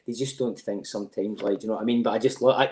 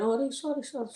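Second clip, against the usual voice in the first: sudden flustered apology; 13 semitones higher than usual. Retold or imitated. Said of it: "I went".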